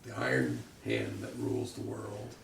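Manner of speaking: low, dark announcer read; ominous slogan. Acted. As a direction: intone it like propaganda. Repeating poster slogan ominously.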